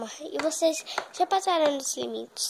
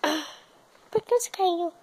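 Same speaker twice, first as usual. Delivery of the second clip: ordinary delivery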